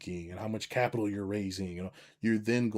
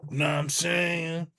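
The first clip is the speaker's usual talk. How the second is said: higher, slow barking drill-sergeant taunt; slow, mocking drill-instructor voice, higher; harsh drill coach; 7 semitones higher, 3 dB louder, slower than usual. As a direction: bark it like a tough coach. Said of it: "I could tell somebody".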